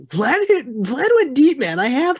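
high, loud excited yelling; excited shout. Retold or imitated. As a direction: shout it like your team just scored. Acted excited student quote.

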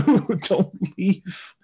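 high, slow hammed-up wail; mock crying. Crying wife begging her husband to stay, mock wail. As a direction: imitate a wife wailing through tears. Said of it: "during her crying".

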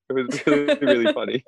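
laughing through the words; genuinely tickled, chuckling as you talk; amused laughing. Real, not acted. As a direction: let real giggles break into the phrase. Cracking up at own story.

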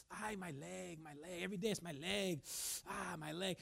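soft, low moan of a sufferer; mock pained complaining. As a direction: play someone groaning over aches, quietly. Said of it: "Why do you keep speaking your symptoms?".

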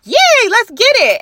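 squealing yay before shots; excited cheer. Retold or imitated. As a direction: cheer like the party's starting. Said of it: "I'm like".

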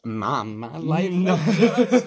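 drawn-out, laughing imitation of a synthetic voice; mock recital. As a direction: parrot the robot recital, stretching the words. Mimics the computer reciting the song.

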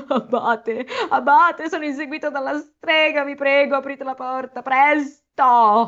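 high, pleading novice voice half laughing; hammed-up crying plea at the door; mock tearful plea. A narrator's reading. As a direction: beg the abbot through fake tears. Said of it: "implorò con voce rotta dal pianto".

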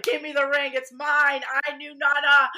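hammed-up family squabble, insisting it's hers; mock demand. In a family battle over grandma's ring, loud mock demanding. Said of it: "I was like".

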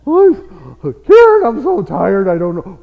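loud, high mock morning moan; exaggerated imitation of a tired wife; mock complaining. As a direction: act out a weary complaint, big and high. Acts out his wife's morning complaint.

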